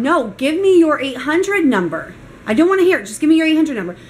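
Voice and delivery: re-enacted angry insistence, building in pitch; insistent demand. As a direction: cut her off and refuse to listen. Retold demand cutting off the clerk.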